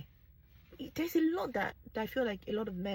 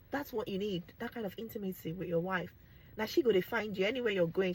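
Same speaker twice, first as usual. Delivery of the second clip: ordinary talk, nothing marked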